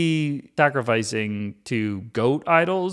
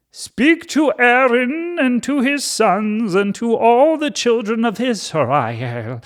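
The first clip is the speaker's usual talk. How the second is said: slow, booming God voice, much higher and louder; 10 semitones higher, 4 dB louder, slower than usual. Acted. Vlogger reading Leviticus aloud, booming slow voice of God. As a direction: proclaim it slowly like a mighty deity. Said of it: "so that's the accent of God".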